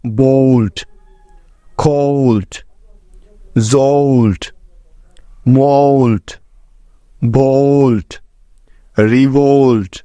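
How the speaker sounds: each word said slowly and clearly, ending on a falling tune; word list demo. Shown as a demonstration. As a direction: model every o sound clearly, word by word. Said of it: "যেমন". (ধীরে স্পষ্ট উচ্চারণে শব্দ তালিকা)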